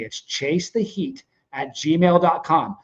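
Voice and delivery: spelled out slowly; slow, deliberate. Real, not acted. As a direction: give the address slowly and clearly.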